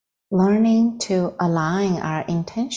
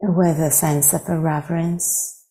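unhurried phrases with long silences between; guided meditation. Real, not acted. Teacher leading meditators into arriving with the breath, guided meditation. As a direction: guide gently, leaving space after each phrase.

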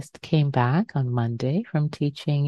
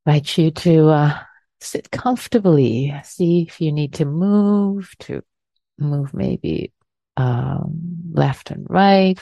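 guided meditation. Acted. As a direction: ease them gently into stillness.

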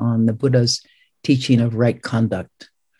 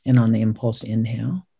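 guided meditation. Real, not acted. Zen teacher leading students in counting breaths, guided meditation. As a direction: guide them gently through each breath, leaving space.

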